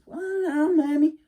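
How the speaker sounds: put-on a worried boy doubting himself; Wiley doubting he can beat the hairy man, high and fast; doubtful young Wiley. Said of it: "I said".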